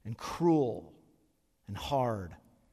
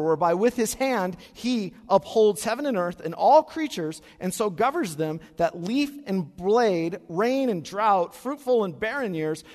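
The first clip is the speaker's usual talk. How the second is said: solemn loud recitation; 4 semitones higher, 7 dB louder than usual. Acted. Pastor reciting the creed to the church, solemn and loud. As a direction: proclaim it like a creed.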